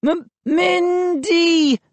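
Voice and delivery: high, slow, drawn-out groan; feeble moan. A narrator's reading. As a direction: moan the name haltingly. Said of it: "I moaned".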